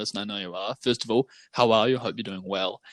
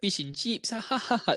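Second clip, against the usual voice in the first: higher-pitched mock Kiwi "fish and chips" with fake laugh; mocked New Zealand accent; 7 semitones higher, 4 dB quieter than usual. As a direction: caricature a New Zealander, then a high ha-ha-ha. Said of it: "That's not how we talk".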